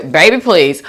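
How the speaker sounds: ordinary talk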